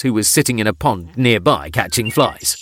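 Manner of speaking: plain reading voice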